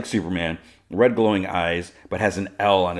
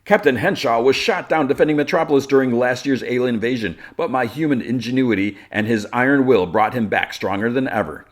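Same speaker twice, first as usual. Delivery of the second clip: higher, louder proclamation, easing off; public speechmaker; 5 semitones higher, 4 dB louder than usual. Retold or imitated. Lex at microphone praising his hero, proclaiming. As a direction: proclaim it to a crowd.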